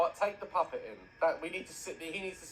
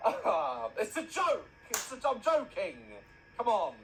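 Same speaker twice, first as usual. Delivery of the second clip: playful high laugh; 9 semitones higher, 4 dB louder, slower than usual. Shown as a demonstration. Laughing at own dark joke.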